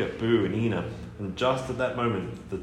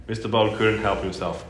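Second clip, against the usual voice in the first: ordinary delivery, nothing marked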